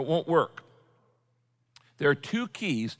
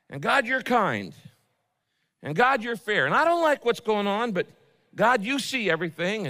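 higher and louder, pleading with God; fervent praise and plea; 6 semitones higher, 3 dB louder than usual. Acted. Worshipper appeals to God's goodness, fervent and loud. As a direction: build a passionate appeal to God. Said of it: "complaining to God and at the same time saying".

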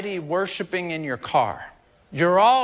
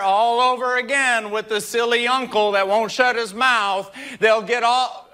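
preaching at full force; 5 semitones higher than usual. Acted. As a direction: keep the congregation lifted, shouting it out.

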